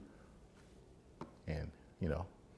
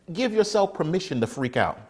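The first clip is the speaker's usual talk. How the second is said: usual speaking voice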